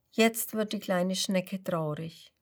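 plain reading voice

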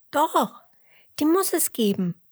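high, loud sheep voice insisting; insistent sheep. Acted. Emmerla insists, much higher.

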